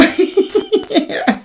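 a quick squeaky giggle; high-pitched laugh. Real, not acted. Laughing at a quarrel story.

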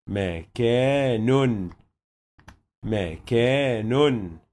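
مكان slowly, the final نون drawn out as "makanun"; slow syllable demo. Shown as a demonstration. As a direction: spell out مكان with its tanween, unhurried. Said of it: "هيتنطق بالشكل ده". (نطق مكان ببطء مع التنوين)

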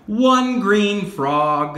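slow, over-clear teacher voice; slow clear modelling. Shown as a demonstration. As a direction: say it slowly for a child. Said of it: "teacher models phrase slowly".

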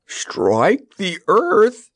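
slow, high-pitched roar of alarm; drawn-out, high roar. A narrator's reading. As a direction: roar it in disbelief, stretching each word. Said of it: "roared the Lion".

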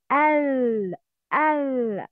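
"al" with a long, held L. Shown as a demonstration. Teacher showing tongue position for lam qamariyya, drawn-out L in "al". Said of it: "you raise your tongue".

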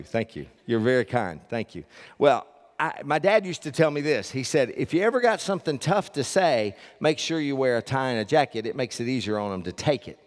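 ordinary talk

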